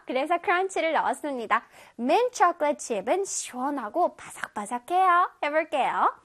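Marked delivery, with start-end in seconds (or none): none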